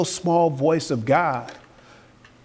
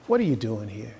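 voice of God; 7 semitones lower, 7 dB quieter than usual. Acted. Preacher voicing God questioning Elijah, low and hushed. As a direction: lower and much softer, a hushed divine question. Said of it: "there came a voice unto him and said".

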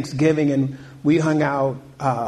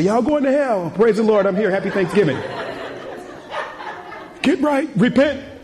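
much higher mock hellfire preacher; 8 semitones higher than usual. Pastor mocking preaching damnation at family Thanksgiving, over the top. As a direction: lampoon the Bible-thumper at Thanksgiving. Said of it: "go over there with my Bible and say".

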